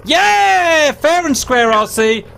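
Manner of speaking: triumphant cheer. Real, not acted. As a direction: crow over the win.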